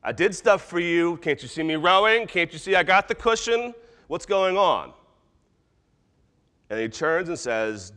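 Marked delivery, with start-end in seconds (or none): 0.0-4.9 high, raised voice of grievance; indignant demand. Acted. Disciples grabbing God to wake him, indignant demand. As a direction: protest as the unthanked helper, pitched up. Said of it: "tried to grab God and say".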